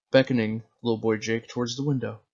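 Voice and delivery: plain reading voice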